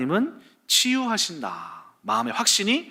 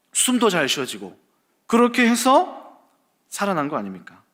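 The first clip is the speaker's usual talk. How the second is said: ordinary talk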